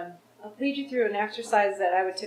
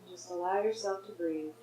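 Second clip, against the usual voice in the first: guided meditation. Real, not acted. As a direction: gently guide them to notice, leaving space.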